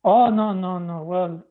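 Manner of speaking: normal talking voice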